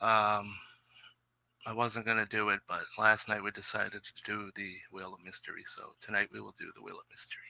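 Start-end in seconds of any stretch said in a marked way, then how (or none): 3.4-7.4 whisper. Real, not acted. Aside under his breath.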